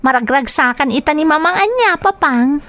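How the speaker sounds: put-on high, young child voice talking to her father; a little girl asking her papa eagerly. Child tells her father about mother.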